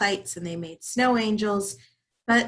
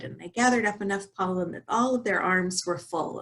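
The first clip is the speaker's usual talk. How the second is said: usual speaking voice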